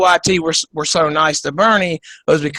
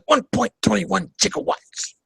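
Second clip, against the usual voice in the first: softer old-politician impression, strained declaiming; Bernie Sanders; 3 semitones higher, 6 dB quieter than usual. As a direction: imitate the excitable senator, voice held back. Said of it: "He said".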